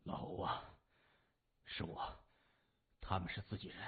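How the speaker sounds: wounded man speaks low and hushed, barely voiced; injured Ah Shui whispering to his old friend; low, muffled voice. A narrator's reading. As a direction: murmur it weakly from someone's back. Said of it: "低沉着说".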